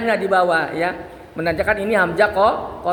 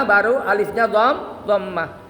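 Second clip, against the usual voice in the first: ordinary talk, nothing marked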